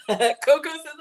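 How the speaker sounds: high, loud, bursting with laughter; laughing. Real, not acted. Reading a viewer's joke and cracking up, laughing loudly. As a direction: laugh as you start reading a comment.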